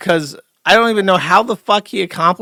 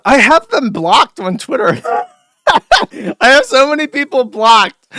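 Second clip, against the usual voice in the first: laughing; 10 semitones higher, 8 dB louder than usual. Real, not acted. Laughing about blocking many people.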